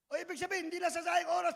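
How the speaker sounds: ordinary delivery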